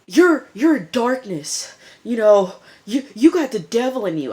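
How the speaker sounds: mock frantic warning; freaked-out accusation. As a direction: imitate a spooked cult member crying out. Cult guy freaking out at Pope.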